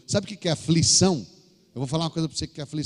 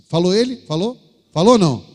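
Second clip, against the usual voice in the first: loud challenge; 2 semitones higher, 11 dB louder than usual. Real, not acted. Preacher pressing the congregation, shouted questions.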